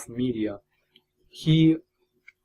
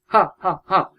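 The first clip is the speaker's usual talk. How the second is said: higher, louder mock squealing "Ha, ha, ha"; mock dubbed squeals; 7 semitones higher, 6 dB louder than usual. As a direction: imitate the cheesy dubbed squeals from the films. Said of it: "they were just, like, squeals".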